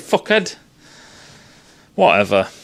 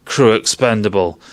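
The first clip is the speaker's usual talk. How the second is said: "Crew expendable" at normal level as a cold computer command; mock computer order; 7 semitones lower than usual. Voicing Mother giving the order, a mild mock computer command. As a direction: deliver the order outright like the ship's computer. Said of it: "I was waiting for it to say".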